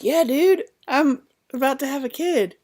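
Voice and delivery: high, loud, frantic announcement; urgent and excited. Retold or imitated. Woman in labor tells the nurse, loud and frantic. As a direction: blurt it out, pumped and panicky.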